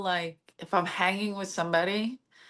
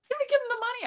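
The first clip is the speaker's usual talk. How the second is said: anxious pleading; much faster than usual. Retold or imitated. Companions pleading during retold robbery.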